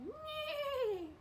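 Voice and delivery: high whinnying neigh; whinny like a horse. Acted. Cow whinnies a high neigh. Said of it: "The cow said".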